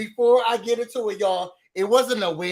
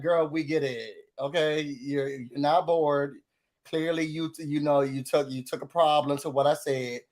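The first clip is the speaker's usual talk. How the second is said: low, dismissive quote; 7 semitones lower, 4 dB quieter than usual. Retold or imitated. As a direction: voice dropped low and unimpressed. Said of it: "British is like".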